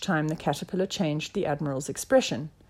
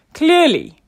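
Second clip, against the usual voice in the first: loud, high pronouncement; pompous detective deduction; self-assured inspector; 10 semitones higher, 10 dB louder than usual. A narrator's reading. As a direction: declare it as obvious. Inspector declares his deduction.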